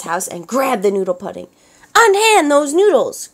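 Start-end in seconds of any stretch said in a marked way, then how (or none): 2.0-3.3 high, loud indignant cry; cried out. A narrator's reading. Grandma crying out at pirates grabbing her noodles, loud. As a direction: order them off the pudding. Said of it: "cried Grandma".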